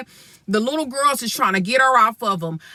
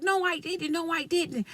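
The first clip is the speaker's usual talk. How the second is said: hushed high denial, softer; softer mock denial; 8 semitones higher, 9 dB quieter than usual. Boy denying to his mother, hushed and scared. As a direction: whisper the denial like a scared kid.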